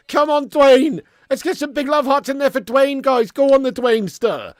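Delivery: loud, high rallying cheer; rally the chat behind him; hype shouting. Real, not acted. Streamer rallying viewers to cheer the donor, loud and high.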